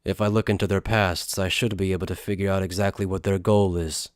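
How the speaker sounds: low, understated character voice; compliant accomplice. Acted. Accomplice agreeing to look into their pasts, low-key.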